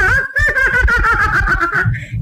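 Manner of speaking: a high, pleased giggle from the little man; gleeful high cackle. Acted. Dwarf cackles after being called a hero, high glee.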